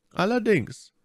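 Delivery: high-pitched character voice; name the price in a high put-on voice; bargaining captain. A narrator's reading. Ship's captain setting his fare, high-pitched.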